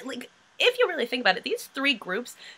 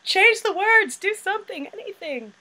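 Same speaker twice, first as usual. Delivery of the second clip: frantic friends yelling to stop; mock panicked shout; 10 dB louder than usual. Friends at karaoke yelling in mock panic. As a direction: shout it high and loud. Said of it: "all of them being like".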